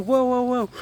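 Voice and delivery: high, loud startled plea; acted-out panicked shout; retold alarm. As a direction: cry out to halt an armed man, high-pitched. Guard grabs rifle at him.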